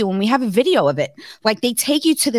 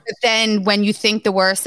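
ordinary talk, nothing marked

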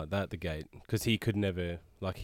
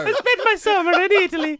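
much higher, loud hammed-up Italian accent; mock Italian accent; 11 dB louder than usual. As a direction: boast in a joke Italian accent. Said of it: "Why is she Italian?".

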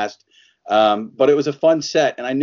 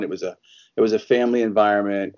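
ordinary talk

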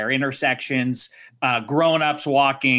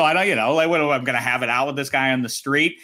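usual speaking voice

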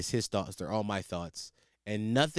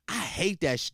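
ordinary delivery

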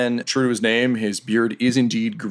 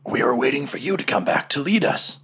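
whispered, loyal confiding; hushed, covert answer; secretive whisper; 4 semitones higher than usual. Acted. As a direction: confide it under your breath. Cook confides to captain in secret.

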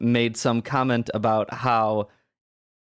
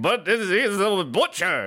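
higher, slow insistent father voice; Tevye; 7 semitones higher than usual. Retold or imitated. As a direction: coax it like a stubborn old father. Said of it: "And Tevye was like".

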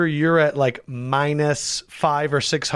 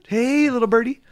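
playful high voice; 7 semitones higher than usual. Pretend. Playful bird voice.

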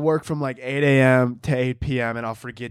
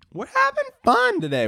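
very high squeaky put-on voice; silly high voice. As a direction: squeak it out for fun. Silly aside voice.

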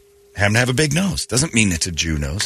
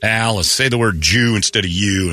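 ordinary talk

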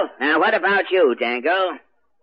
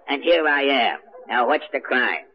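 ordinary delivery

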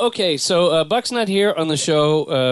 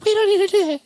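Hillary impression; faster than usual. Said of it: "she did a little thing".

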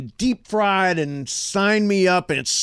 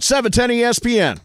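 ordinary delivery

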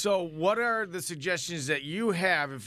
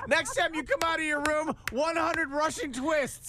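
drill sergeant; 9 semitones higher than usual. Pretend. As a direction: lay down the punishment like a coach. Workout punishment order.

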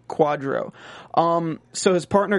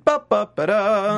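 higher vocalized "ba ba ba da" sting; mock dramatic fanfare; 4 semitones higher, slower than usual. Co-host punctuates the undercover police station reveal, mock dramatic fanfare.

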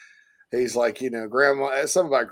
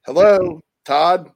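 caller voice; 4 semitones higher, 6 dB louder, slower than usual. Retold or imitated. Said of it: "Todd's like".

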